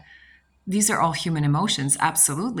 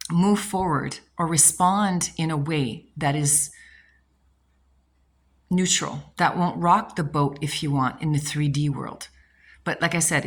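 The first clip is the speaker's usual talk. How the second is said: ordinary delivery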